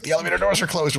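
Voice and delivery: high, urgent mock alarm; high-pitched urgent retelling of panic; retold panic. Friend panicking in retold elevator story.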